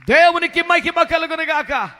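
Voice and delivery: preacher's loud praise call to the congregation; loud proclamation. Real, not acted. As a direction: ring it out loud and high.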